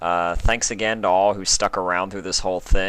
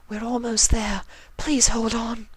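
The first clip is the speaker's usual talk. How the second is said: high, pleading lady's voice; pleading Rarity. Acted. Rarity begging Spike to hold on, high. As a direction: beg him to hold on.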